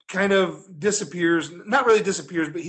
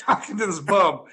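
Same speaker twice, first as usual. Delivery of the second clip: ordinary talk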